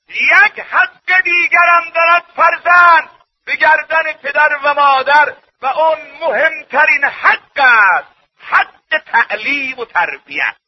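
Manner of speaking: full-force preaching. Acted. Preacher announces the child's most important right, full-force preaching. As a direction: declare it from the pulpit.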